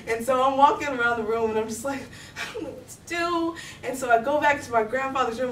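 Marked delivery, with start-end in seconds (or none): 2.4-3.5 higher, strained retold fretting; helpless panic. Girl alone pacing beside unresponsive grandmother, helpless. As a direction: act out mild panic, pitched up.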